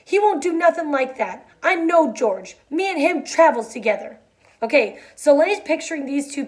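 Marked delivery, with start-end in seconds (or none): 0.1-4.2 high-pitched frantic insisting; pleading, higher-voiced denial. A narrator's reading. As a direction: protest desperately in a raised pitch. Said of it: "Lenny cried frantically".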